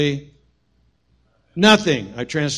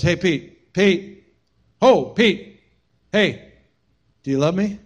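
voiced Jesus; 4 semitones higher, slower than usual. Jesus calling Peter.

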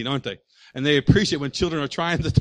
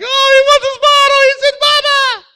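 very high, loud cooing parent voice; sing-song baby-talk parent; doting parent; 13 dB louder than usual. Retold or imitated. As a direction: coo like a delighted parent.

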